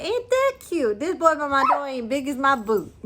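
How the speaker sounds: high, cooing giant lady. A narrator's reading. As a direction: coo down at the tiny boy. Giant lady coos at tiny boy.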